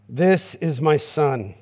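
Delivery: low, slow and solemn; solemn divine pronouncement; voice of God. Acted. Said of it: "The voice of God".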